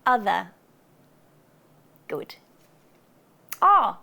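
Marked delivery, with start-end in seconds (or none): 0.1-0.5 non-rhotic ending; schwa ending. Shown as a demonstration. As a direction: say "other" as uh-thuh. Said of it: "Another one is".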